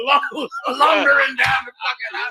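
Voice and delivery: loud, laughing ridicule of the walk; laughing mockery. Real, not acted. Mocking the athlete's walk.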